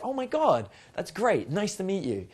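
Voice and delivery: animated greeting without overdoing it; dynamic, energized. Shown as a demonstration. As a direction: start high, then ease into a friendly hello. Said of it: "speak slowly and still be dynamic".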